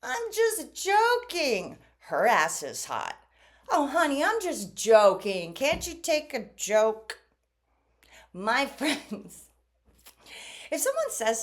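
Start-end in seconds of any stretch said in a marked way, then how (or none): 0.0-7.2 slow, higher, drawn-out mock "I'm just joking"; mocking manipulator. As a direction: sneer it like a partner brushing off an insult. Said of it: "Have you ever heard that one?".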